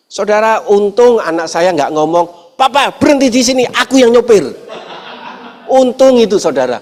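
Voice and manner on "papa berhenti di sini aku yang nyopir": put-on high, demanding kid voice; a kid ordering dad to pull over; bossy child. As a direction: son telling dad to stop so he can drive, high and bossy. Imagined child demanding to drive.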